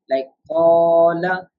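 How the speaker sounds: "qala" with a long stretched alif; stretched long vowel. Shown as a demonstration. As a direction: draw out the "a" in "qala". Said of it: "so we are stretching".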